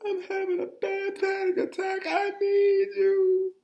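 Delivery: panicky, shaking voice. Retold or imitated. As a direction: plead in a snot-dripping panic, voice way up. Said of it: "in my most panicky, snot-dripping, shaking like someone who just saw a ghost voice".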